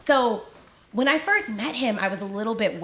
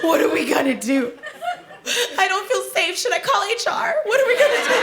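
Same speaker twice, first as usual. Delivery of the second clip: climbing ever higher into a loud frantic squeal; hammed-up panic; 10 semitones higher, 4 dB louder than usual. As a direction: coworker Crystal, more and more hysterical, panicking over donuts. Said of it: "She's like".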